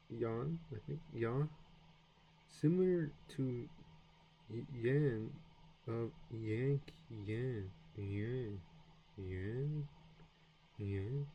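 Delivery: nasal yan drawn out, repeated; French nasal ien. Shown as a demonstration. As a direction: practise yan through the nose. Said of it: "nasal sound, I E N".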